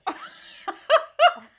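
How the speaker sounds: high-pitched cracking-up laugh; high burst of laughter. Real, not acted. Player amused by failed accent, high laugh.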